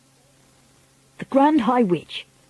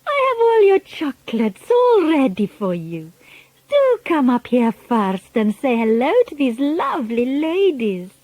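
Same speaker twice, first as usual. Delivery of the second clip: put-on high, sugary witch voice coaxing a boy; wicked witch luring with sweets; Grand High Witch; 4 semitones higher than usual. A narrator's reading. Grand High Witch luring Bruno up onto the platform, sugary.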